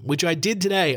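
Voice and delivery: ordinary delivery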